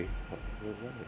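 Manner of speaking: breathy whisper under his breath; whispered aside. Real, not acted. Host whispers at his stalling screen share. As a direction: mutter it to yourself, barely audible.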